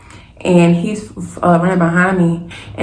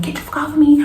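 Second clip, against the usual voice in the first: high-pitched mock yell at talking volume; retold yelling; 9 semitones higher, faster than usual. Woman punching the man choking her, retold yelling. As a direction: re-enact fighting someone off, voice pitched up. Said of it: "I'm yelling over and over again".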